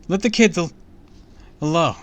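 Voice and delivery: loud, high desperate plea; frantic protest at full voice; screamed demand. A narrator's reading. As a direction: shout at him to release the children. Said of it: "I screamed".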